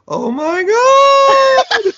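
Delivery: very high, loud, drawn-out squeal; imitated shrieking laugh build-up; voice shooting up. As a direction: stretch it into a piercing mock shriek. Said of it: "her voice would go up when she would start to laugh".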